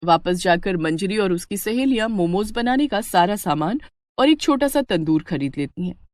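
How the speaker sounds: ordinary delivery, nothing marked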